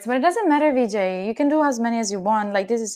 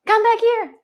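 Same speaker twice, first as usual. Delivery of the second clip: loud, much higher call; calling the dog; 12 semitones higher, 7 dB louder than usual. Real, not acted. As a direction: call the dog back across the yard.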